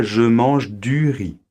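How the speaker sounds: "je mange du riz" slow and clear; slow repeat-after-me. Shown as a demonstration. Teacher asking learners to say it along, slowly. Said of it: "tu veux la prononcer avec moi".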